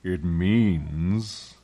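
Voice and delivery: measured, resonant lion's voice; stately, drawn-out opening; solemn Aslan. A narrator's reading. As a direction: begin a weighty explanation.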